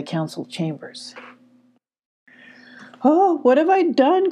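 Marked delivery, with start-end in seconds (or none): 3.0-4.3 high-pitched, distressed wail; very upset cry. A narrator's reading. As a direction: cry out in dismay. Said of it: "cried Emmy, very upset".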